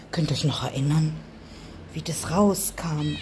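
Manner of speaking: fast, unvoiced whisper. Real, not acted. Owner whispering to her viewers about the sick cat. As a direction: lean in and whisper it quickly.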